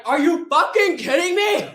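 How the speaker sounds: high, loud shout of disbelief; full-voiced retold incredulous outburst; outraged yell. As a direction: explode at them, imitating an outraged friend. Sasha's retold outburst at diabetic hiker.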